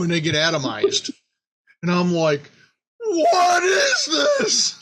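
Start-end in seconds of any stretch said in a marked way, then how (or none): 3.1-4.7 astonished outcry. Retold or imitated. Watching the cartoon, amazed at the screen, slow high cry. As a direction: stretch it out slowly in wide-eyed disbelief.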